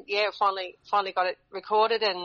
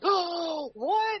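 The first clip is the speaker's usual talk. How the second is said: mock stunned gasp; 10 semitones higher than usual. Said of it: "capture her going".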